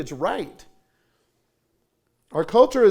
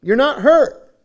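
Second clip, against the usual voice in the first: firm; 8 semitones higher, 11 dB louder, slower than usual. Retold or imitated. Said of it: "I said".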